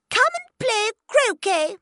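high, loud royal shout; the Queen shouting at Alice to play. Acted. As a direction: shout the command across the lawn. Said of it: "the Queen shouted at Alice".

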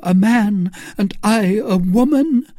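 high, pleading woman's voice; pleading Milady. A narrator's reading. Milady begging Felton to let her die, high and pleading.

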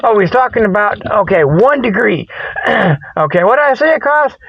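ordinary delivery

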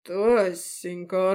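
slow, drawn-out feigned innocence; fake surprise. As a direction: stretch the name in false surprise. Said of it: "фальшиво удивился".

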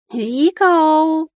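высокий протяжный мяв; позови хозяйку истошно, по-кошачьи; кот зовёт на помощь. A narrator's reading. (протяжно взвыть котом)

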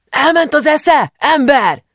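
high, loud, drawn-out yell; alarmed shout. A narrator's reading. Young man drags Dénes back from tank, shouting. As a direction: yell at him in disbelief.

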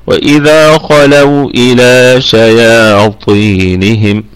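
very slow, sustained tajweed recitation; Quranic recitation. Shown as a demonstration. Tajweed teacher demonstrating the verse, slow measured recitation. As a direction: draw out the long vowels as in recitation. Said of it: "هكذا".

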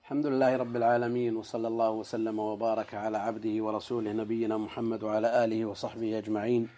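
ordinary talk

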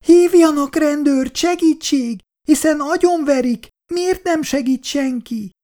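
very high, desperate cry for help; shouting over noise. A narrator's reading. Girl trying to outshout the band during a fight, crying out. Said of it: "Icu megpróbálta túlkiabálni".

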